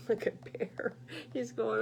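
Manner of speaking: ordinary delivery, nothing marked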